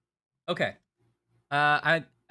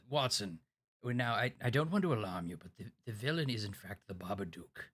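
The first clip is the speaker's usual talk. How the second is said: mock French accent; 4 semitones lower than usual. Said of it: "so fun to say Babadook in a French accent".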